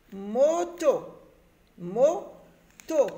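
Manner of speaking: "Moto" loud, in two stretched syllables. Shown as a demonstration. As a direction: sound out "Mo-to" clearly for young readers. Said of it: "la deuxième syllabe du mot".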